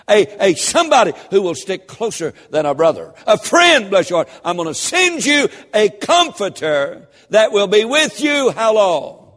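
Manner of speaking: loud, high, rising sermon delivery; fiery revival preacher building to a cry; full-force preaching. Acted. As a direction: preach it hard and lift the room.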